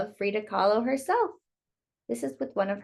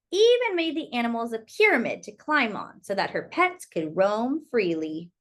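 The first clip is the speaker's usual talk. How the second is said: story narration; 2 semitones lower, 3 dB louder than usual. Acted. Reading Frida's story to children, lilting storyteller voice. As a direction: tell it like a bedtime picture book. Reads picture book aloud.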